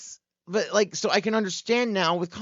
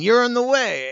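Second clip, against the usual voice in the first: louder, higher dad warning; a hint of a bossy dad; lightly voiced dad; 9 semitones higher, 8 dB louder than usual. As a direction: call it out like a dad wanting past. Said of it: "like your dad saying".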